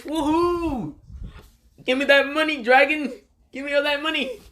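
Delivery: high-pitched excited yelling; gleeful whooping. Retold or imitated. Naruto demanding his winnings from Dragon, gleeful yell. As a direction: crow it out high like a winner. Naruto crowing over a won bet.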